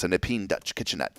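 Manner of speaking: soft, put-on old British accent; mock British accent. Host reads cabinet listing to co-host, in mock British accent. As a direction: read it in a pretend British accent. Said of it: "I don't even know what accent this is".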